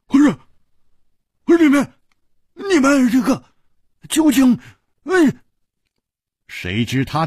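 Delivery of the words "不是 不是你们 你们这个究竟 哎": much higher and louder, sputtering in rage; furious outburst. A narrator's reading. Hu Tiehua rages at the masked men, high and loud. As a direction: blurt it out in disgusted anger. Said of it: "大怒地说".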